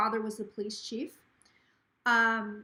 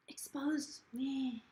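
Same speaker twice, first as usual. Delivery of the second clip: whisper; 8 dB quieter, much slower than usual. Acted. Gossip about Zach exposed.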